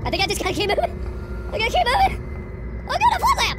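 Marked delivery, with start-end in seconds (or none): 1.5-3.6 strained panic. Real, not acted.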